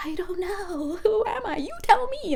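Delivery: high-pitched mock helplessness; flustered protest. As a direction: climb high as if throwing the question back. Retold flustered answer to guides' question.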